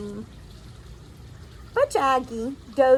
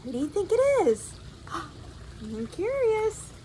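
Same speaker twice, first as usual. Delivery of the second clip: ordinary delivery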